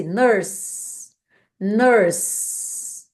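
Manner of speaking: "Nurse" ending on the s sound, with no vowel after it; teacher models "Nurse", stopping cleanly on the s. Shown as a demonstration. Teacher showing the class how "Nurse" ends, closing on a crisp s. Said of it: "finalizar a pronúncia dessa palavra no S".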